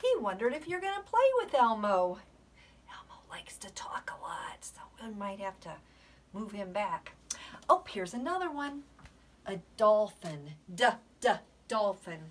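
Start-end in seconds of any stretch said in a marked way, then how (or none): 2.9-7.1 hushed confiding aside; whisper. Real, not acted.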